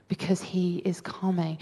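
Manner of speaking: soft, near-whispered reverence; hushed. Real, not acted. Preacher telling listeners of the Lord's return, hushed. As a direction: drop almost to a whisper for the weighty news.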